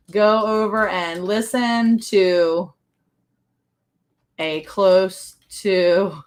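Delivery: slow teasing emphasis breaking into laughter; drawn-out teasing repeat. Real, not acted. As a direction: drag every word out through a laugh.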